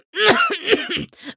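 a short, high dry cough. Acted. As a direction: cough once, sharply. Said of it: "hustete ein drittes Mal".

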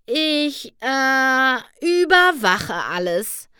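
hesitant, then drawn-out and self-important; awkwardly forced. A narrator's reading. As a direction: stall on the "äh", then stretch out the claim. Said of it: "Rarity lachte gezwungen".

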